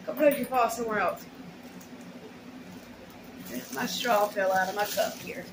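normal talking voice

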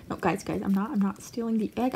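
low, soft voiced excuse from a rat; Bean. Rat caught stealing egg, low soft mock denial. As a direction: play the rat's quiet, low denial. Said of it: "She's like".

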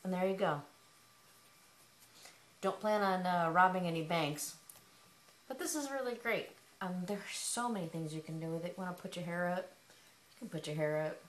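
muffled behind cloth; speaking through fabric tube; through a face covering. Real, not acted. Seen 3: Fabric tube covers her mouth and nose, briefly lowered near 1-2s.

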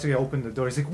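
ordinary talk

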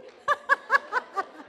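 high burst of embarrassed laughter; real laugh. Real, not acted. Speaker laughs at overrunning her time, high giggling laugh. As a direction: laugh helplessly at yourself.

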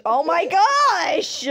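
big slow exclamation; exasperated exclamation. Real, not acted. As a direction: stretch it out in exasperation. Exasperated by co-player's sign.

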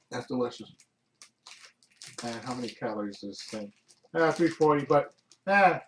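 talking while chewing; speaking between bites; mouth full. Real, not acted. Seen 1: hand brings cupcake piece to mouth, then mouth closed chewing.

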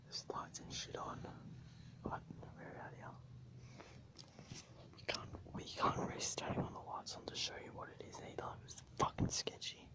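ordinary delivery

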